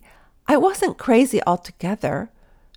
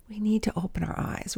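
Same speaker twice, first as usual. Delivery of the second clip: whispering; 7 dB quieter, faster than usual. Acted. Emphatic sermon point.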